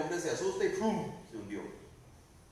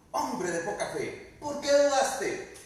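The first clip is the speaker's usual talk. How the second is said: slow, rising rebuke, louder at the end; scolding; 7 semitones higher, 5 dB louder, slower than usual. Acted. Jesus scolding Pedro for doubting, firm rebuke. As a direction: scold him like a disappointed master. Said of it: "lo agarró y lo regañó".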